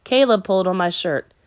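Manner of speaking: plain reading voice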